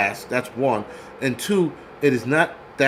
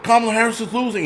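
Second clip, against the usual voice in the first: mock guy in car; 8 semitones higher, faster than usual. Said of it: "He's like".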